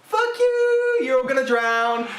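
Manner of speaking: very high, loud mock jeer; hammed-up taunt in a screechy high voice; gloating Noah. Noah crowing at the drowning from his ark, high gloating shout. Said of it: "Noah's just like".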